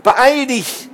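high, pressing shout; urgent call. A narrator's reading. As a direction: urge them to hurry over the radio. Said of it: "rief er in sein Funkgerät".

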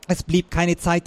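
usual speaking voice

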